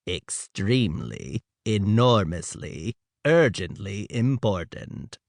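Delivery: low, slow robotic alien voice; mechanical voice. A narrator's reading. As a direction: speak like a machine-like alien. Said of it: "the alien said in a mechanical voice".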